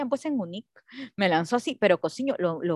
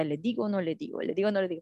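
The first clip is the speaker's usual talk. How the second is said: retold scared child; 2 semitones lower, 8 dB quieter, faster than usual. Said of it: "Con mi cara de asustada y decía".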